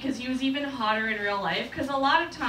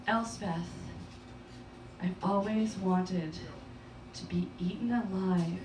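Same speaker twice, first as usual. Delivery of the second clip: low creepy whisper; 6 semitones lower, 4 dB quieter than usual. Retold or imitated. Date's creepy confession, low.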